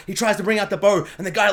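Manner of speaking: ordinary talk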